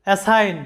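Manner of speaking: অ্যাসাইন said "a-sain", g silent; অ্যাসাইন, no g sound; English word assign. Shown as a demonstration. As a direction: model অ্যাসাইন in two syllables. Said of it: "এরপরে দেখো".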